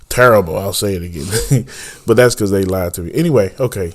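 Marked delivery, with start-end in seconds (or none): 0.1-0.6 emphatic repeat. Shown as a demonstration. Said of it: "It was terrible then".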